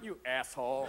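low, soft cat putdown; cool cat's muted insult; disdainful cat. Acted. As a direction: drop it low and quiet in passing. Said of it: "Your cat just walks past your dog going".

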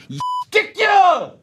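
high, slow, drawn-out yell; stretched-out bellow of rage; furious shout. Acted. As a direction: roar the insult with all you have. Yelling at absent rival.

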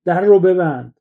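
shouted order. A narrator's reading. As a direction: yell it at him to close the door. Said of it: "فریاد زد". (فریاد بلند و زیر: در رو ببند)

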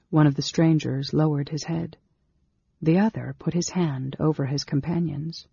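ordinary delivery

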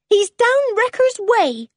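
put-on high, loud child's announcement; slow, high-pitched piping; young Jan. A narrator's reading. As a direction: announce it in a high little voice, drawn out.

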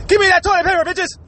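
high, loud hammed-up looter shouting; a toilet-paper thief yelling at shoppers, hammed up; mock looter yell. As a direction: bark it like a crazed looter grabbing toilet paper. Imitating looters with machetes.

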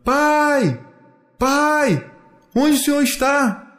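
high, insistent searching call; long-held high holler. A narrator's reading. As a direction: keep calling for your missing dad. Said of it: "Ele continua gritando".

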